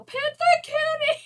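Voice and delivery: high, loud, gleeful call; yelling to the cats. Retold or imitated. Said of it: "I just run outside and yell".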